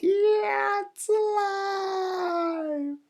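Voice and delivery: very high, extremely drawn-out shriek; hammed-up high panic, held long; comic scream. A narrator's reading. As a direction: wail it in mock terror. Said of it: "And the lady screamed".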